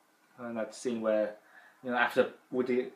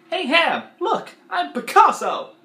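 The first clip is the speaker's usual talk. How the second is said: much higher, loud, slow put-on cartoon voice; loud, high mock Potato Head insults; Mister Potato Head impression; 14 semitones higher, 9 dB louder than usual. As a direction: belt it out as a cranky toy. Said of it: "I sound a bit like Don Rickles when I do Mister Potato Head".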